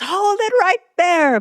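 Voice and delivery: high mock shout of alarm; sharp, high warning shout. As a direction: yell it like catching an intruder. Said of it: "And she shouts".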